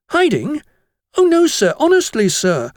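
high, loud schoolboy denial; innocent protest. A narrator's reading. Jennings denies hiding from the master, high innocent protest. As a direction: protest your innocence in wide-eyed astonishment. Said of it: "Jennings was round-eyed with innocence".

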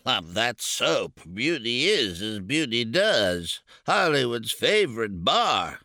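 mock the ad with disgust; disgusted parroting. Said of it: "the man parroted to Vic, With great disgust".

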